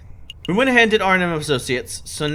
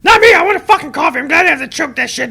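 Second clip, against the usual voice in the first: eager outburst; 12 semitones higher, 7 dB louder than usual. Real, not acted. Eager outburst about coffee.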